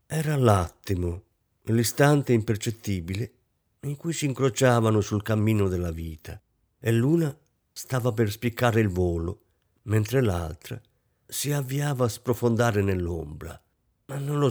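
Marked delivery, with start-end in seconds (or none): none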